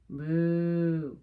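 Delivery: a loud, drawn-out mock moo; playful cow lowing. Cows typing refrain.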